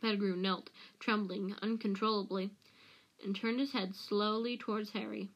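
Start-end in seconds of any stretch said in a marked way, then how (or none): none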